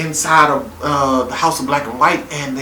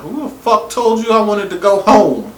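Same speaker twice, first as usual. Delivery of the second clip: indignant mother; 6 semitones higher, 4 dB louder than usual. Retold or imitated. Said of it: "His mother's like".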